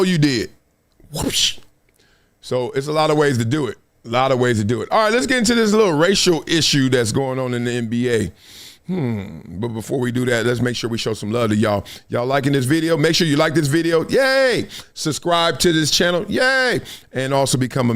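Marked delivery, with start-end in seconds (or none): 1.1-1.6 imitated whoosh sound. Shown as a demonstration. Coach gets you if you go wrong, imitated whoosh. 14.1-14.7 high-pitched little "yay"; playful cheer. Pretend. Host asking viewers to like the video, playful cheer. 16.3-16.8 high-pitched little "yay"; playful cheer. Pretend. Host asking viewers to like the video, playful cheer.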